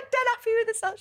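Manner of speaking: high, pleased sing-song; pleased with herself. Retold or imitated. Describing her housemate pleased with herself, high sing-song. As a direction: float it up high, smug and happy.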